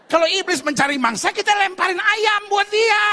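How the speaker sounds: high, loud comic protest; mock indignant shout. Real, not acted. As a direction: exclaim it in loud exasperation at the congregation. Mock outburst at wrong answer.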